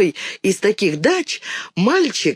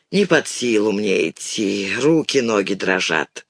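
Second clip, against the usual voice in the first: put-on exhausted old man; 8 semitones lower than usual. A narrator's reading. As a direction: let it sink, drained of strength. Exhausted old man cannot walk on.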